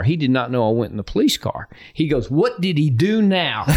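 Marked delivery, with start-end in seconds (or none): 2.3-3.6 higher, drawn-out disbelief; mildly exasperated retold question, stretched out; exasperated coach. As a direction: act out a coach's slow, pained disbelief. Retelling coach's exasperated phone reaction.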